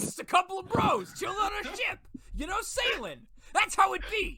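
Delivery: high, loud hollering bro; rowdy bro shout. Acted. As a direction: shout it like a hyped-up sailor buddy. Acting out bros on a ship.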